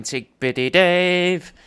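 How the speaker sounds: high, loud, slow mock chant; imitating the refrain. Mocking his own refrain for the listener, high and slow. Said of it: "it just sounds like I'm going".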